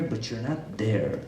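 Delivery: a quiet, heavy not there; softly, slowly, almost to yourself; dropped low and soft. Shown as a demonstration. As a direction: say it slow and hushed, letting not sink. Contrasting presence with absence.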